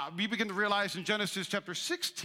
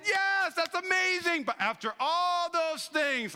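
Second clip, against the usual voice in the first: much higher, slow, exaggerated God voice; a grand, drawn-out divine reply; God's voice; 11 semitones higher, 4 dB louder, slower than usual. Pastor acting God answering the churchgoer, high and slow. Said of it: "And God is saying".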